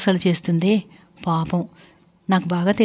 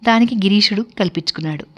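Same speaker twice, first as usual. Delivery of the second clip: plain reading voice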